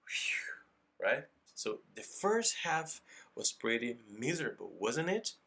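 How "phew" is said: voiceless blown "phew"; sigh of relief "phew". Shown as a demonstration. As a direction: blow out "phew" without any voice. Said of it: "é tipo assim". ("phew" soprado, sem voz)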